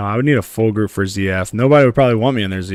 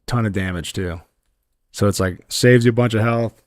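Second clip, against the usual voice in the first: ordinary talk, nothing marked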